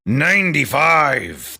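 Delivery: a drawn-out mock score call, lightly voiced; darts announcer call. Vlogger trying out the darts announcer voice, mild mock call. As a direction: slowly stretch the number like an arena announcer. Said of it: "that kind of".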